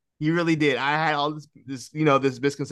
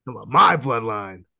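imitating the Rock; 4 semitones lower than usual. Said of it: "he said".

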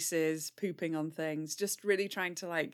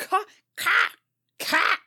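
crow caw; 14 semitones higher, 6 dB louder than usual. Acted. Player imitates crow.

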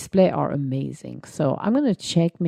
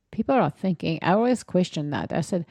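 normal talking voice

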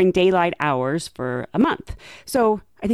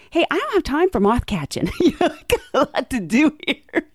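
mock exasperation; 8 semitones higher than usual. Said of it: "I basically wanted to say".

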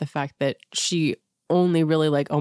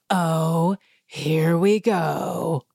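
slow, drawn-out here we go; exasperated sigh of a line; mock eye-roll; much slower than usual. Imitating Anne Marie's weary dismissal, slow.

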